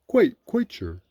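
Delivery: high, loud bear voice; insistent Pooh. A narrator's reading. Pooh assuring Rabbit who he is, insistent.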